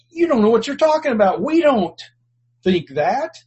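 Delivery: hammed-up haughty scoff; dismissive leaders. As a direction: brush them off with disdain. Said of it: "They were simply saying".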